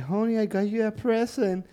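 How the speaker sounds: high, slow sweet mock husband; doting husband giving his wife a gift; husband's voice. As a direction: sweetly hand over the gift. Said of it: "él decía como que".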